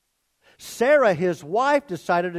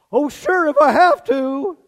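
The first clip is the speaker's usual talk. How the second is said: mock-reluctant, higher and louder, barely hiding eagerness; Abraham, feigned reluctance; 8 semitones higher, 8 dB louder than usual. Abraham answering his wife's offer of her servant, mock-reluctant. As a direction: play up a put-upon yes that is all too willing. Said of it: "He's all too willing".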